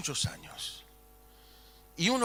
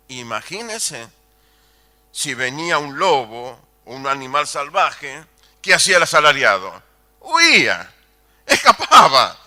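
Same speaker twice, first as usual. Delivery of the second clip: loud, ringing pulpit declamation; preaching at full force; 2 semitones higher, 13 dB louder than usual. Acted. Preacher booming to the congregation about God, full-force preaching. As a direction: proclaim it to the back pews.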